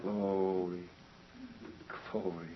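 low, very soft exclamation; hushed praise. Real, not acted. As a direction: let it out quietly, dropped in pitch. Hushed praise after the closing point.

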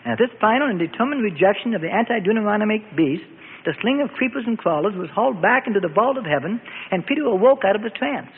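ordinary delivery, nothing marked

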